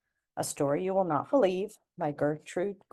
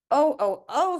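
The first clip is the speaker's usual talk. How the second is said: rising, louder oh, oh, oh; dismayed old woman; 10 semitones higher, 5 dB louder than usual. Acted. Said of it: "said Granny Glittens".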